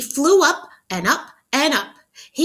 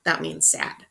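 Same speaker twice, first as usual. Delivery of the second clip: plain reading voice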